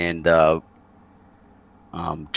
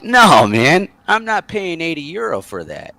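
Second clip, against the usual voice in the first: indignant refusal; 8 semitones higher than usual. Retold or imitated. Customer refuses the bar owner's demand, acted indignation. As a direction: flatly refuse to be ripped off.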